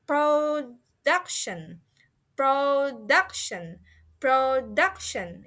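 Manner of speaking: "production" slowly, syllable by syllable, DUC stressed; stress on middle syllable. Shown as a demonstration. Teacher models word stress for learners, slow "production" with DUC stressed. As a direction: stretch "production" out and lean on DUC. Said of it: "the stress or the emphasis fallen or happen in the middle".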